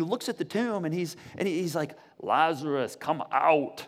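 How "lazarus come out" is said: low, commanding action-hero call; Arnold Schwarzenegger style. Acted. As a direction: call it out like a movie tough guy, pitched down. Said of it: "like Arnold Schwarzenegger style or something".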